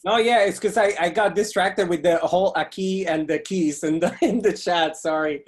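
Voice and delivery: high, loud flustered laughing apology. Real, not acted. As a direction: laugh through the excuse. Guest caught distracted.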